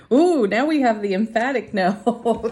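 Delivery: delighted, laughing ooh then loud, high announcement; laughing surprise at an emphatic no card; surprised laugh. Real, not acted.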